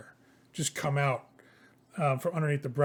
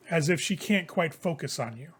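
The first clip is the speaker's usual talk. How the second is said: usual speaking voice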